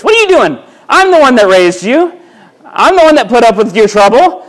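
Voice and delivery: outraged mother, voice shooting high; indignant mama. Pretend. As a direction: shriek like an insulted mom. Mama indignant, slaps child.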